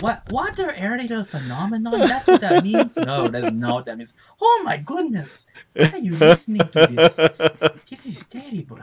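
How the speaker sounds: high, put-on scandalised voice; mock outraged voice. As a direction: act shocked and disgusted.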